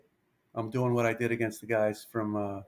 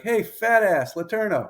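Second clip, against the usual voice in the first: retold heckle, higher and louder; heckling yell; 7 semitones higher, 5 dB louder than usual. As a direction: yell it down from the bleachers. Heckler yelling from the stands.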